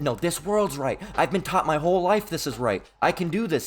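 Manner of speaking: usual speaking voice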